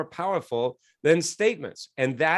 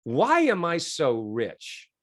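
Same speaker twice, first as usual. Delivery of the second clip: slow trial question; much slower than usual. Shown as a demonstration. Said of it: "And then I said".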